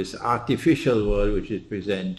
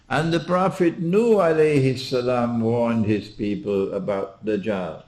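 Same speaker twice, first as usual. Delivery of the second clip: ordinary delivery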